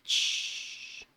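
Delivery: long voiceless "shhh" hiss; imitated bus brakes. Narrator imitates the bus braking suddenly, long hiss. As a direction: mimic air brakes releasing.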